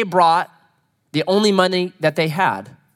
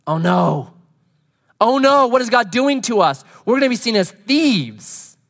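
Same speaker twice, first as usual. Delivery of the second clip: hammed-up frightened brothers, pitched up; mock panic; 5 semitones higher, 3 dB louder than usual. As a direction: play the brothers' panic as a light parody. Brothers panic over returned money.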